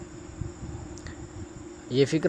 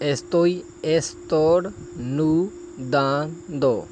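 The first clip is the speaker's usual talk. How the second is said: drawn-out Spanish, every syllable stretched; very slow model phrase; much slower than usual. Shown as a demonstration. Teacher slowly models a hard Spanish phrase for students. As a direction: spell it out in sound, very slowly. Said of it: "اگلا فقرہ ہے".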